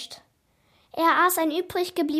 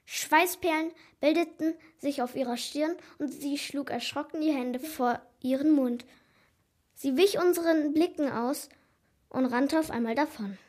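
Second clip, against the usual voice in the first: plain reading voice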